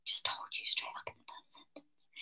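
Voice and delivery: breathy whispered aside. Real, not acted. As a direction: whisper it to the listeners.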